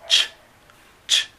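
two short sharp "ch" bursts; ch sound demo. Shown as a demonstration. Teacher demonstrating the third column's sound, short sharp demo. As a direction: clip out "ch, ch" twice. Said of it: "en la tercera de la C H".

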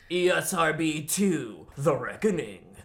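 loud, slow, dramatic title-card voice; mock trailer announcer. Host announces a theme song and sequel title, hammed-up announcer. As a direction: boom out the sequel title, drawn out.

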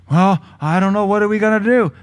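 mock fretful voice. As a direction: whimper like a fretful worrier. Voices the fearful inner voice.

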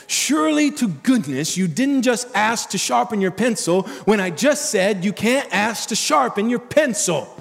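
a teacher at the end of her rope; teacher scolding a student about the pencil, exasperated. Retold or imitated. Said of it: "a teacher could be exasperated".

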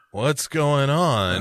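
slow, dazed, drawn-out question; groggy Michael Shannon. Retold or imitated. Waking up amid the angry crowd, slow dazed question. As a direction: come to, still half drugged. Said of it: "for him to be like".

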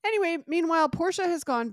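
loud high jump to the next bit. Real, not acted. Recapping the soap for a friend, loud and high. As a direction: push on loudly.

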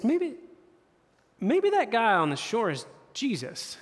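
hesitant, high-pitched wondering; dawning realization. Retold or imitated. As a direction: let the realization land slowly, starting high. Peter's dawning realization, retold.

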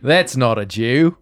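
slow mock Australian accent; Paul Hogan impression. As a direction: lightly play Crocodile Dundee. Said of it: "It's Paul Hogan".

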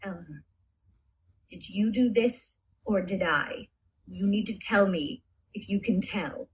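low, hushed warning voice; whispering to Eleanor close up. Acted. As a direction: quietly press her with a warning. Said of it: "with a very, like, warning tone".

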